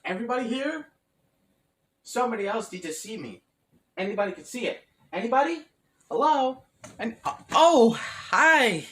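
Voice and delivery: high, loud put-on call. Calling out to empty room.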